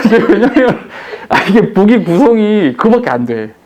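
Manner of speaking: talking through real laughter; laughing. Real, not acted. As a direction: chuckle while explaining. Laughing at himself.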